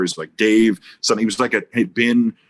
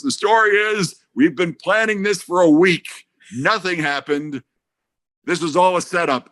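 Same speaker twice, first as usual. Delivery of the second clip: mock emphatic reveal; 7 semitones higher than usual. [name] revealing the setup to Janofsky, emphatic mimicry. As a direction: spell out the prank with acted relish. [name]'s acted triumphant reveal of prank.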